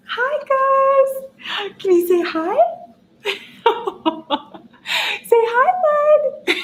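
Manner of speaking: very high, sing-song cooing. Real, not acted. Greeting her puppy Gus on camera, high cooing baby talk. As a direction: squeal sweetly at the puppy.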